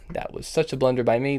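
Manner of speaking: ordinary talk, nothing marked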